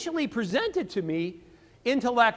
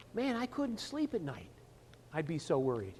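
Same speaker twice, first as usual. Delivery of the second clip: much softer, drawing the listener in close; lowered confiding voice; 5 semitones lower, 9 dB quieter than usual. Shown as a demonstration. Sales coach demonstrating how to draw a prospect closer, hushed. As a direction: drop to a soft, almost whispered confession. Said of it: "I'm gonna lower my voice to a whisper".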